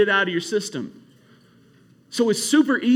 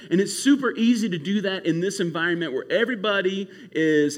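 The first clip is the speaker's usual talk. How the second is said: ordinary talk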